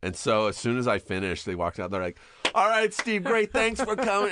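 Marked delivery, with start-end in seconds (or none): 2.5-4.3 very high, loud staged cheer; fake cheerful send-off. Producers faking delight as the actor leaves, loud cheer. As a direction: ham up a cheerful goodbye. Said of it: "can you all laugh really loud".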